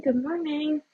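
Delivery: bright, high-pitched repeated hello; cheerful high greeting. Real, not acted. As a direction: sing out the greeting to newcomers.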